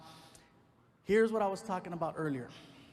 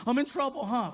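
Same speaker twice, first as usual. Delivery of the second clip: put-on worried kid; 8 semitones higher, 7 dB louder than usual. Kid voiced.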